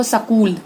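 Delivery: स्कूल said as सकूल, with an extra a breaking up the sk; Haryanvi mispronunciation. Shown as a demonstration. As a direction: imitate the Haryana way, सकूल with an inserted vowel. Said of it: "तो गलत है ये".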